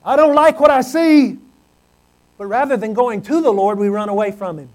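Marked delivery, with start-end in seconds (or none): 0.0-1.4 higher, louder, sulky protest; voiced complaint. Preacher voices the congregation's louder, higher complaint. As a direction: whine it like someone who hates the mirror. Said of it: "and we say".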